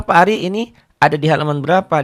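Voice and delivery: ordinary delivery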